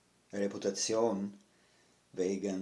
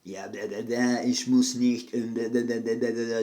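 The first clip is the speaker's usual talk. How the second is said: mocking attitude; 3 semitones higher, 8 dB louder, faster than usual. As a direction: imitate his attitude with loud, derisive da-da-da babble. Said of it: "ich hab ein Attitude bekommen".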